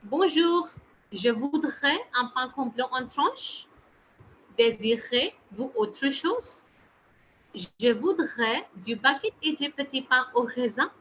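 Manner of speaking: slow, careful model French pronunciation; French accent. Shown as a demonstration. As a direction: read out a French dialogue slowly for learners. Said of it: "show you a French accent today".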